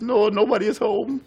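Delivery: put-on child's answer. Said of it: "and he says".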